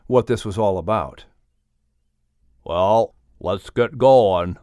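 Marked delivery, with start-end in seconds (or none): 2.6-4.6 a big friendly bear talking low and easy; lower, hearty bear voice, jollying his friend along; bear Mel, lower voice. Acted. The bear Mel cheering up grumbling Murray, lower bear voice.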